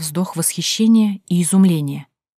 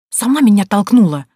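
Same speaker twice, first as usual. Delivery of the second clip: louder, defensive retort; irritated, raised-voice comeback; snapping back; 3 semitones higher, 7 dB louder than usual. A narrator's reading. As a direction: snap the blame straight back at her. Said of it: "огрызнулась Танька".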